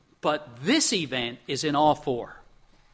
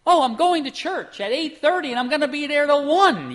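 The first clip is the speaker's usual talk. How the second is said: mock eager announcement; 9 semitones higher, 4 dB louder than usual. Mock eager reply one avoids giving.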